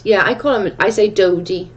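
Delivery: usual speaking voice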